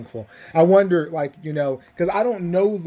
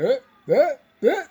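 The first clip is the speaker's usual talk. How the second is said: higher, louder sheepish eh eh eh; awkward hedging eh; 10 semitones higher, 4 dB louder than usual. Retold or imitated. Maze caught out by the orgy joke, sheepish stammer. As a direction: stall like you've been caught.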